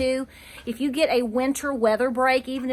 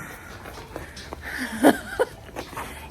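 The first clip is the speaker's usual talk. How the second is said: soft laugh; 5 dB quieter than usual. Real, not acted. Laughs at roof cost.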